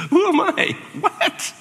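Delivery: very high, loud squeak; squeaky panic. Pretend. Moses answering God's call with a squeaky startled squeal. Moses' first words to God.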